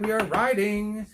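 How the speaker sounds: drawn-out hype. Acted. Hyping the segment start.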